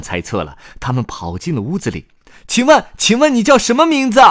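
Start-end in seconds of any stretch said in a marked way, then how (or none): 2.5-4.2 high, loud chick voice; shouted question. Acted. Chick Camelido wakes the boy, high shouted question. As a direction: call out the question urgently, very high. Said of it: "卡梅利多喊道".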